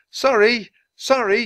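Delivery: high, loud frantic apology; shouted apology. A narrator's reading. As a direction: call sorry to an angry crowd. Boy apologising to angry merchants.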